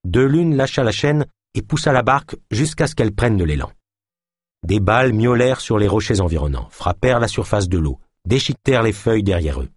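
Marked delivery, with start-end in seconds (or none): none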